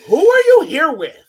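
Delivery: high, loud call across the room. Retold or imitated. Asking the little girl who she's with, loud high call. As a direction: call out to find who she belongs to. Calling out to a lost child.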